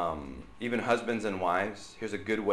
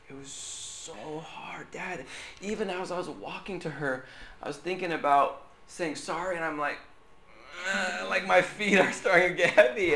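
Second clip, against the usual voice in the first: put-on higher, strained little-girl voice retelling the hard moment; imitating his daughter; 9 semitones higher than usual. Pastor voicing his daughter's apology walk, mildly childlike and strained. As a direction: play the daughter, pitched up and struggling. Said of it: "she didn't say that".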